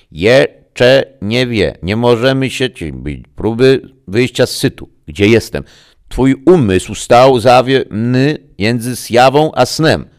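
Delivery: high, loud, slow broken transmission; a voice breaking up over a bad link; garbled radio voice. A narrator's reading. Greta calling Max through a failing link, garbled and high.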